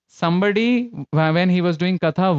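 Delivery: ordinary talk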